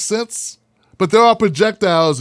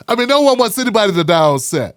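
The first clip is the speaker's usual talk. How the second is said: ordinary delivery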